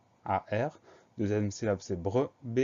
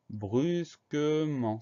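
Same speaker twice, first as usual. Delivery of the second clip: "brusquement" stretched out slowly, higher pitched; drawn-out dictation; 5 semitones higher, much slower than usual. Shown as a demonstration. Teacher introducing the last word of the spelling list, drawn-out dictation. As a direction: draw out "brusquement" syllable by syllable for a learner.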